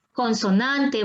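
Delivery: ordinary talk, nothing marked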